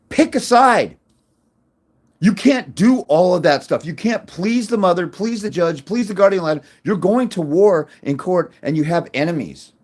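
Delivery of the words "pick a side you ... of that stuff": much higher and louder, a hard command; emphatic shout. Real, not acted. Lawyer scolding indecisive dads, loud and emphatic. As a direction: bark the order at the viewer. Lecturing viewers who won't fight.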